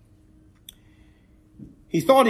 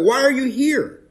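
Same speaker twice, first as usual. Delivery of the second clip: God's challenge; 10 semitones higher, 4 dB louder than usual. Retold or imitated. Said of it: "he wanted Elijah to think about".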